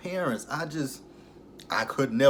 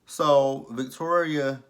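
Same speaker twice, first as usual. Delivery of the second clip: usual speaking voice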